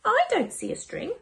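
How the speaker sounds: a hint of a puzzled boy; lightly voiced child. Child character voice.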